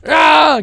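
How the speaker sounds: loud high "ah" yell; sudden full-voiced scream; effort scream. Real, not acted. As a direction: let out a high, loud cry. Yell while grabbing game item.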